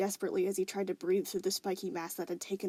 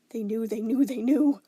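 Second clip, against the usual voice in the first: louder and louder panic; panicked realization; 2 semitones higher, 9 dB louder than usual. Acted. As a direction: spiral into dread.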